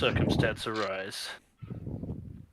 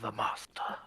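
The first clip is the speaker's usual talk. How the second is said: dramatic whisper; 4 semitones lower, 7 dB quieter than usual. Acted. Grand whispered reveal.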